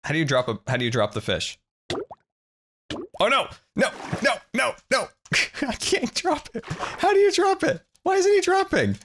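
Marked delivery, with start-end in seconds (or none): 3.2-9.0 high panicked whine; frantic squeal. Real, not acted. Frantic, can't drop fish.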